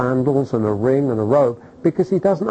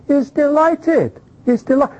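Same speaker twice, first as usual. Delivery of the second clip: delighted exclamation; 10 semitones higher than usual. Acted. Joyful emphatic claim.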